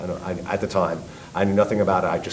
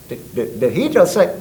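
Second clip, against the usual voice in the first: put-on Texas accent; 8 semitones higher than usual. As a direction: sound like a stunned Texan. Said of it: "Very heavy Texas accent".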